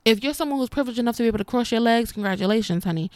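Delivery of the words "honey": usual speaking voice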